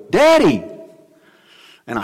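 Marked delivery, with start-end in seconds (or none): 0.1-0.6 put-on high bright call of a small boy across a crowd; little child calling. Preacher recalls his son calling across an airport, excited child call. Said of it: "I hear this little voice go".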